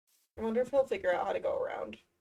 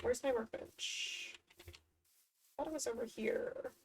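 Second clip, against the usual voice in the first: whispered; 11 dB quieter than usual. Real, not acted. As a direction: whisper it while looking around. Searching quietly.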